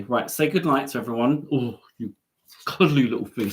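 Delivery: ordinary talk, nothing marked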